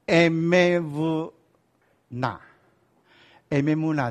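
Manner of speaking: Emevuna stretched out very slowly; syllable-by-syllable demo. Shown as a demonstration. Teacher teaching the class a Hebrew word, Emevuna drawn out syllable by syllable. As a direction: sound out Emevuna one held syllable at a time. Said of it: "所以".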